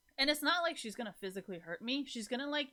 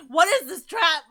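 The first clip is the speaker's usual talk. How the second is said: laughing shout; 9 semitones higher, 17 dB louder than usual. Real, not acted. Viewers' question, answered with a loud laughing yell. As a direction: shout it through real laughter at the trap.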